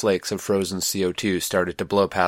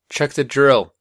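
higher, louder call across the room; raised voice calling after the departing team; calling after them; 6 semitones higher, 5 dB louder, slower than usual. Acted. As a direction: call it after people who are leaving. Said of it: "Rockwell called after them".

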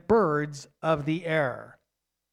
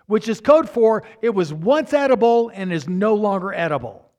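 loud, higher sermon voice, pressing each word; fervent preaching; 5 semitones higher, 7 dB louder than usual. Acted. Preacher explaining koinos to the congregation, loud and fervent. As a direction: drive the point home from the pulpit.

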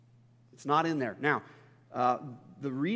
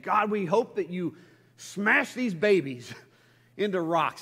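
hammed-up angry plea to God, louder; loud, higher mock outrage; mock furious prayer; 4 semitones higher, 6 dB louder than usual. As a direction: imitate someone venting fury in prayer. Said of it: "they're furious".

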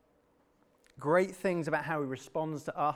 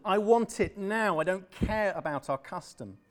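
loud insistence; 6 semitones higher, 6 dB louder than usual. Acted. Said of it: "Obed said".

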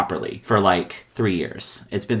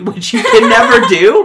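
higher and louder through laughter; laughing; 12 semitones higher, 10 dB louder than usual. Real, not acted. Cracking up about the lawyer's rudeness, laughing. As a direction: laugh it out as you say it.

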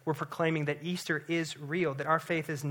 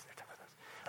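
whispered aside; 25 dB quieter, faster than usual. Real, not acted. Hushed aside to the congregation.

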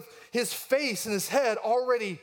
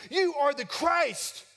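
much higher, slow and proud proclamation; eager blurt; 9 semitones higher, slower than usual. Retold or imitated. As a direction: jump in, bursting to answer first. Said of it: "Peter jumps in and says".

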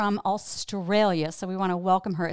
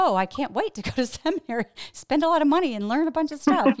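higher, louder, quick pretend enthusiasm; mock eagerness; 8 semitones higher, 4 dB louder, faster than usual. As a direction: gush sarcastically as if you can't wait. Mimics eagerness she never had.

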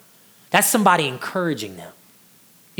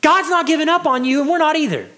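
much higher, eager, uplifting call; encouraging apostles; 9 semitones higher than usual. Retold or imitated. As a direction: lift the church up with bright hope. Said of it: "They say".

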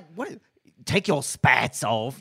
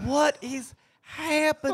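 shocked disbelief. Acted. Partners reacting to the chef's fake chin, stunned. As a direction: stretch the Oh in disbelief.

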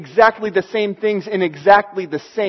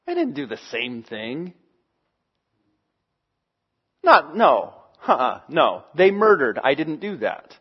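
defensive objector; 6 semitones lower, 4 dB quieter than usual. Pretend. Voicing defensive listener.

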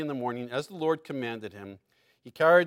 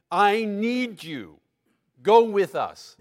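louder, higher pleading, slowing on I need you; urgent plea; 5 semitones higher, 9 dB louder, slower than usual. Acted. Moses begging God to go with them, loud plea. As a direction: beg him not to leave you behind.

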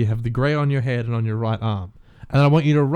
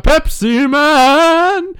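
Pepsi Man inflection; 12 semitones higher, 6 dB louder, much slower than usual. Shown as a demonstration. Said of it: "very difficult without doing it".

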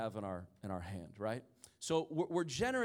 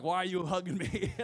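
raised, louder playful protest breaking into laughter; mock bewildered, laughing; 5 semitones higher, 6 dB louder than usual. Preacher voicing the hugged pastor in heaven, mock bewildered laughing.

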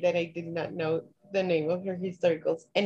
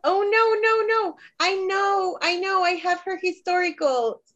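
high, panicked and flustered; panicked squeal; 11 semitones higher than usual. Real, not acted. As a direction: squeal it out, caught on the spot. Stumped in the memory game.

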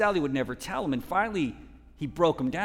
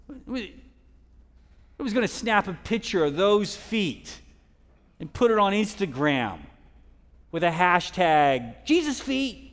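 incredulous mockery; 6 semitones higher, 5 dB louder than usual. Real, not acted. Rhetorical mockery of Instagram beauty.

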